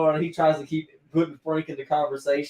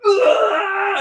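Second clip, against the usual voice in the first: loud, high retching noise; mock retching; 8 dB louder than usual. Friend acting out Charlie throwing up on his date, mock retch. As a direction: heave as if vomiting blood. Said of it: "he, like, vomits blood".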